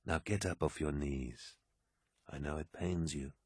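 very soft, tender instruction; gentle kindness. A narrator's reading. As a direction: bid your friend rise, softly. Horus gently raises kneeling aide.

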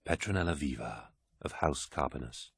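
soft, measured reading of a name; reading aloud. A narrator's reading. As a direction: read it off quietly, half to yourself. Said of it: "reading the contents of the slate".

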